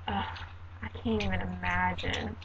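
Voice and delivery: low, hushed groan of dread; downbeat dismay. Retold or imitated. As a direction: sigh it out softly, as if it's awful news. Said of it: "or even an upbeat tone".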